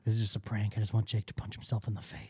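whisper. Real, not acted. Secretly briefs Jackson on the prank.

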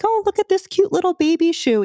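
put-on high cooing baby talk; mother playfully babying her son; motherese. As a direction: gush over a tiny baby shoe. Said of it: "acting like".

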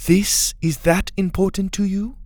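disbelieving question. A narrator's reading. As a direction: ask it as if you can't believe it. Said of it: "in disbelief".

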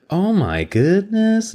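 high, slow, hammed-up gasping wonder; exaggerated squeal of surprise; mock amazement. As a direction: draw it out high like an astonished admirer. Acts out reaction to his heritage.

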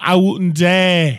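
loud, drawn-out mock British; slow it right down in hammed-up British; British accent. Refusing co-host's crude offer, mock British accent. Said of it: "Can you do a British accent?".

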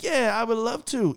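high, falsely keen agreement; hammed-up eagerness hiding humiliation; fake cheerfulness. Same fake cheerful goodbye.

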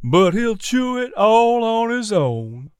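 sarcastic drawl. A narrator's reading. Miz P finishing her quip about Bill, sarcastic drawl. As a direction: stretch every word sarcastically. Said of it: "Miz P drawled sarcastically".

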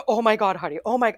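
dog owner upset as her dogs run off, loud. Retold or imitated. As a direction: blurt it out in a panic. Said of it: "so upset".

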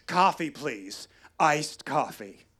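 lentement, en détachant « Iced coffee »; slow deliberate order. Retold or imitated. Mom ordering slowly, deliberately.

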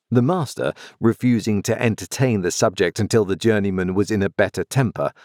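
ordinary delivery

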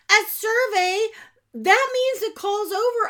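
high, loud shocked disbelief; dismayed surprise. Real, not acted. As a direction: realize the call is lost. Realizes the call dropped.

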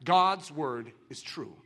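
usual speaking voice

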